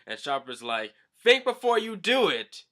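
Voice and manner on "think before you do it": high, loud cartoon scolding; loud high-pitched character voice; Chopper sounding like Brook. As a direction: pipe it up as a cartoon character telling someone off. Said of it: "now Chopper sounds like Brook".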